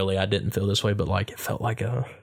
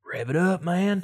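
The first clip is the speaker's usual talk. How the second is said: higher-pitched put-on racer voice; mock cheering on; 8 semitones higher, slower than usual. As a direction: pretend to egg on racers. Playing at egging on street racers.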